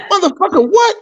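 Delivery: high-pitched, incredulous mock challenge; retold worked-up outburst. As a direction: snap back as if worked up. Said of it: "he already had worked me up".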